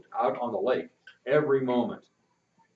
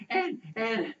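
trailing, softer stammer; stuttering; 11 semitones higher, 5 dB quieter than usual. Acted. Boy runs out of boasts, stammering softly. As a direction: run out of words and stall. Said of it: "he stuttered".

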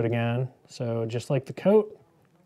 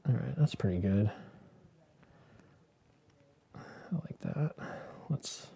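soft whisper to herself; whispered aside; 9 dB quieter than usual. Real, not acted. Quiet aside while painting.

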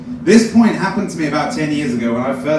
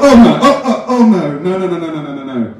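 mock panic; 2 semitones higher, 5 dB louder than usual. Driver realising the trusted fart betrayed him, mock panic.

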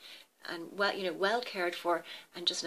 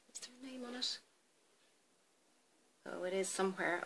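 muttering to herself; 7 dB quieter, faster than usual. Real, not acted. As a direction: say it under your breath while searching. Searching the bottle, talking to herself.

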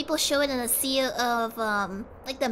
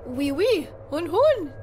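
mock French. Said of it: "Busting the French".

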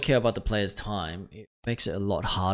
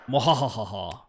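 evil cackle. Pretend. Gamer plays evil villain.

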